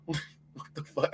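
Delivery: breathless laugh. Real, not acted. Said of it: "Have you seen that name?".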